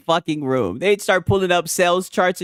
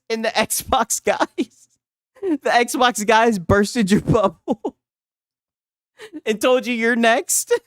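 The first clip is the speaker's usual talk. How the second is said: laughing through words; 5 semitones higher, slower than usual. Real, not acted. Laughing while mocking.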